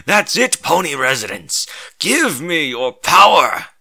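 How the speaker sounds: high, loud, straining battle cry; heroic shout. A narrator's reading. Hero demands power from the ponies, loud straining shout. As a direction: bellow it mid-battle.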